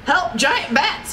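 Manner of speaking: slow, high-pitched cry for help; mildly alarmed storybook cry. A narrator's reading. Gladstone warns the guests about giant bats, crying out. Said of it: "he cried".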